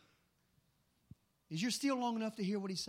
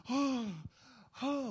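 imitated gasp; 5 semitones higher than usual. Imitating his daughter overcome on the floor, a gasp. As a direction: gasp as if overcome, then let it fade.

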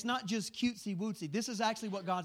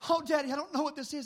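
put-on higher, louder, a boy overwhelmed and frightened; overwhelmed child; 8 semitones higher, 5 dB louder than usual. Father imitating his crying six-year-old son, overwhelmed. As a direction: cry it out like a scared little boy. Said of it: "He says".

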